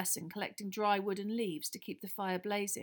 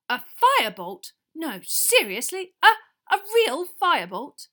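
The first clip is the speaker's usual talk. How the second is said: can hardly believe it; thrilled disbelief; 9 semitones higher, 15 dB louder than usual. A narrator's reading. Wood hearing of the Firebolt, loud and amazed.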